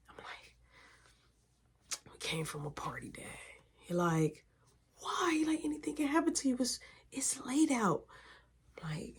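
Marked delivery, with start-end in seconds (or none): 2.1-3.2 soft unvoiced whisper; whispered confession. Son answers dad at night. 5.0-5.3 whispered why. Retold or imitated. Dad whispering at night. 5.6-8.1 a father hushing his worry at night; whispered warning. Retold or imitated.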